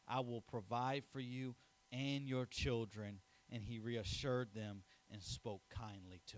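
earnest, measured Bible reading to the congregation; scripture reading. Acted. Preacher reading Genesis to the congregation, solemn and measured.

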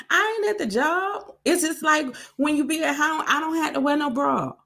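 high-pitched defensive comeback; indignant protest. Retold or imitated. Protests being told not to cuss.

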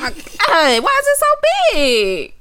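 high, loud amazed gush over a huge Slinky; mock amazed coworker. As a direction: gasp at it like a kid in a toy store. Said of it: "That's my Slinky impersonation".

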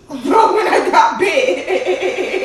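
slow, laughing high and loud. Real, not acted. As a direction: crack up while saying it. Laughing through the story.

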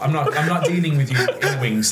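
ordinary talk, nothing marked

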